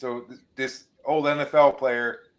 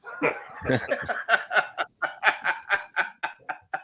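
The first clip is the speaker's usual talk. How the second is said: long breathy run of laughter; laughing; 5 semitones higher, 5 dB quieter than usual. Real, not acted. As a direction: crack up after a punchline. Laughter after the joke's punchline.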